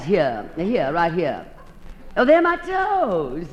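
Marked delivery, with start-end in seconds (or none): 2.2-3.5 surprised realisation. Acted. Surprised at own x-ray.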